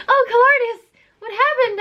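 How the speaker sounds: high, hammed-up damsel cry; melodramatic heroine. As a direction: overplay the worried princess. Reading heroine's line melodramatically.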